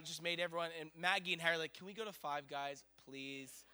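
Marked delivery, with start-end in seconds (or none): 1.7-3.6 soft, low little request; hungry children's plea. Retold or imitated. Hungry kids asking parent for food, soft plea. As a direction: ask quietly, trailing into a softer please. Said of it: "And they're hungry".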